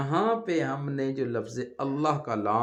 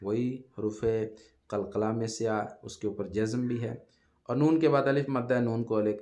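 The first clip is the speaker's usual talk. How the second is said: ordinary talk, nothing marked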